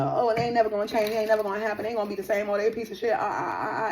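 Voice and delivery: hammed-up whining gossip; mocking gossip. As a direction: mimic people trash-talking. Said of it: "y'all be on the".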